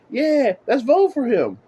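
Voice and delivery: mocking voter. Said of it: "And you saying".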